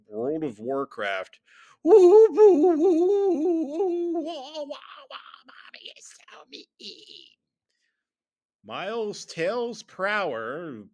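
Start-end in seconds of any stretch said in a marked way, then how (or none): 1.8-7.4 high, loud goblin wail fading into a whimper; hammed-up wailing sob, dying away; goblin crying. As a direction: bawl like a goblin, then trail into faint squeaks. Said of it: "crying like the goblin".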